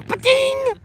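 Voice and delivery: sudden high squeaky "Pa-ding!" yelp; silly squeal. Pretend. Vlogger squeals a joke sign-off at the boss. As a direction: squeal "Pa-ding!" like a cartoon chime.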